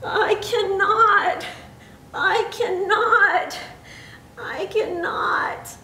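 high, slow refrain fading away; weary chugging engine. A narrator's reading. Old engine rumbling off to the roundhouse, wearily chugging. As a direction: drag it out, weaker as the engine rumbles off.

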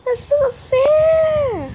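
high, slow, drawn-out loud shout; frustrated shout. Real, not acted. Frustrated gamer losing again.

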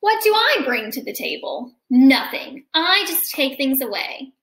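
lively picture-book read-aloud; reading to children. A narrator's reading. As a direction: read it to a class of kids.

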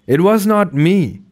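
high frightened denial; frightened cry. Acted. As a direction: blurt it out in panic, pitched high. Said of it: "cried Doughboy".

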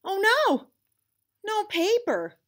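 high, loud alarmed gasp of dismay; panicked cry. A narrator's reading. Lizard realizing there's no paper, high panicked cry. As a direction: shriek at the missing paper. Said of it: "he realizes".

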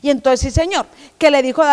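ordinary delivery

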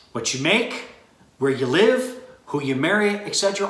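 ordinary talk

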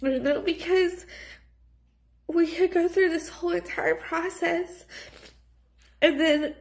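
crying through every word; snotty crying. Real, not acted. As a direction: voice breaking, high and teary. Tearful talk about infertility.

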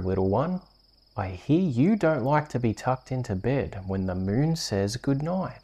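plain reading voice

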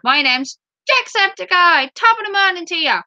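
high, hammed-up Irish greeting; mock Irish accent. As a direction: put on a lively high Irish lilt. Imitates YouTuber's Irish greeting.

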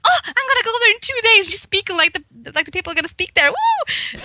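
giddy retold excitement ending in a high whoop; overjoyed. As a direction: bubble over with delight at the news. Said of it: "I was so happy to hear that".

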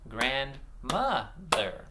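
"Grandmother" broken into separate beats; "Grandmother" slowly segmented for blending; slow syllables. Shown as a demonstration. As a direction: clap out "Grandmother" slowly, pausing between syllables. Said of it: "I'm gonna say them slow and clap".

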